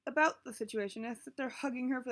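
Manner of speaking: usual speaking voice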